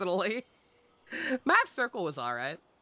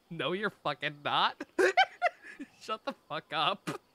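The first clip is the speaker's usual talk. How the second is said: ordinary talk, nothing marked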